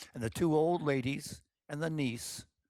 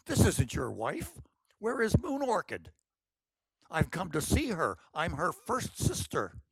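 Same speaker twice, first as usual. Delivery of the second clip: higher, excited voice acting out the confrontation; an older sister staging the scene for her timid sister; bold older sister; 5 semitones higher than usual. Excited, bossy older sister. Brave Orchid's dramatic scheming.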